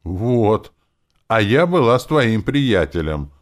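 high, loud, hurt complaint; aggrieved whine of protest; offended. A narrator's reading. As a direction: complain as the slighted one. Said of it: "обиженно заявила Диля".

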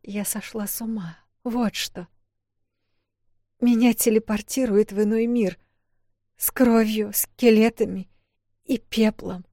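put-on high, strained voice of a frightened girl; distraught young woman. A narrator's reading. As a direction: speak as the scared heroine near breaking.

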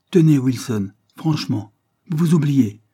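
lively, emphatic telling-off; sharp interruption. A narrator's reading. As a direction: cut in briskly to rebuke a colleague. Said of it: "interrompit vivement Joyce".

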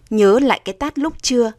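ordinary delivery

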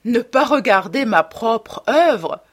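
loud, incredulous questioning; amused exclamation. A narrator's reading. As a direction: protest in astonished amusement. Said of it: "s'exclama Hallward en riant".

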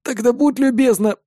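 high, squeezed plea; strained groan. A narrator's reading. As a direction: groan as if hugged too tight. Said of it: "простонал я".

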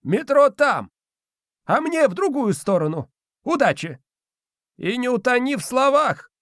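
put-on high, cheery old spirit; squeaky old man, parting jab; domovoi voice. A narrator's reading.

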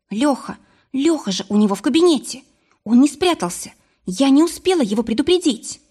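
high-pitched, worried outburst; alarmed. A narrator's reading. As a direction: blurt it out in sudden alarm for a friend. Sudden alarm for friend in danger.

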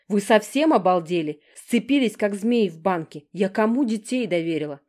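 loud exasperated telling-off; angry scolding. A narrator's reading. Driver scolding sisters in the car, angry loud scolding. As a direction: give them a proper dressing-down.

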